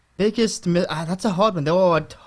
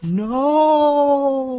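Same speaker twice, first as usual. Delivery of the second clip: spooky ghost voice; 9 semitones higher, 4 dB louder than usual. Pretend. Ghost answers in mock voice.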